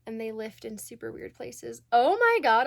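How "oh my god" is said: excited. Real, not acted. Sudden excited story opener.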